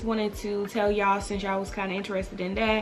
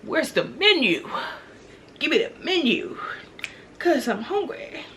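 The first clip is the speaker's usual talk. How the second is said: playful demand; 4 semitones higher than usual. Retold or imitated. Hungry mother asking for the menu, playfully demanding. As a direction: space out each demand with pauses.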